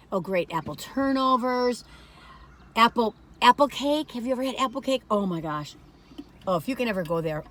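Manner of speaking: ordinary delivery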